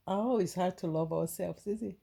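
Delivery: ordinary delivery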